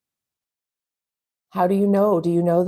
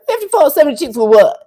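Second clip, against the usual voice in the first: loud, fast, higher disbelief; outraged boyfriend; 9 semitones higher, 9 dB louder, faster than usual. Retold or imitated. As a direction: shout the price back in shock. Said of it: "And he's like".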